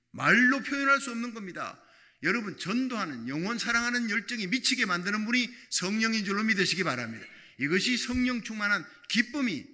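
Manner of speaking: loud, high-pitched sermon cadence; fiery preaching. Acted. Pastor urging the congregation, loud fiery preaching. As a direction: preach it with full force.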